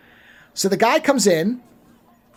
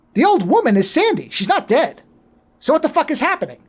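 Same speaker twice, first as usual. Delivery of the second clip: higher-pitched retold bafflement; recounting a stunned reaction, pitched up; astonished realisation; 6 semitones higher than usual. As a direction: work it out aloud in amazement. Retells own shocked realisation on watching.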